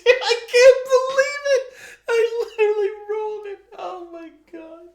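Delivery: very high, squealing through laughter; real hysterical disbelief. Real, not acted. Player amazed they literally rolled it, high and hysterical. Real reaction to a lucky roll.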